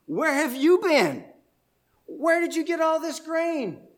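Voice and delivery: high, loud astonished cry; can't believe how much grain she brought; astonished mother-in-law. Retold or imitated. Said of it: "hear the astonishment in her voice".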